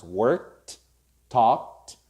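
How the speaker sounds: "worked", "talked" ending in K then released T; one syllable for "worked" and "talked", crisp T last; K plus T ending. Shown as a demonstration. Teacher models K words in past tense, K plus T ending. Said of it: "sounds that finish with k".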